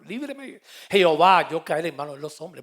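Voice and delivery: David crying out to Jehovah, loud and pleading; desperate plea. Retold or imitated. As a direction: beg the Lord with everything. David begs God for mercy.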